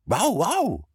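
high-pitched imitated dog yaps; bulldog barking. A narrator's reading. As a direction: yap in agreement like an eager dog. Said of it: "agreed their bulldog".

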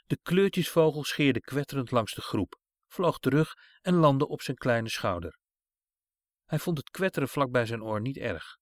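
ordinary delivery

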